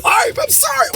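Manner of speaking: high strained pleading yelp; imitating the beaten man. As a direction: squeal out an apology. Imitating victim being beaten.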